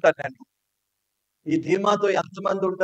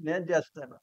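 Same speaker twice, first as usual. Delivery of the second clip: soft; 8 dB quieter than usual. Retold or imitated. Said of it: "కోపంలో ఏమన్నా".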